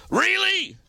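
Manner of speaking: mock-exasperated really; incredulous really. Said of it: "in that voice".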